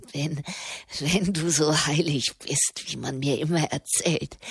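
low grim scorn building to curt commands; grimmig spöttisch. A narrator's reading. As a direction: start low and bitter, then push her away. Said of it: "lachte grimmig in sich hinein". (grimmig, erst tief, dann unwirsch befehlend)

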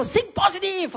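high shouted pep cry; mock pep shout. Retelling his friend yelling pep at him on Times Square. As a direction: bark the slogan like a hype coach. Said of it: "er war so".

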